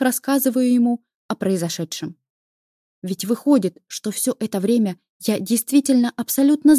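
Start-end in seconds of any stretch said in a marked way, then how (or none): none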